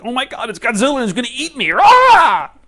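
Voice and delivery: high, loud, rushed panic into a scream; mock terror. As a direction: play monster-movie panic, ending in a shriek. Acting terror of Godzilla.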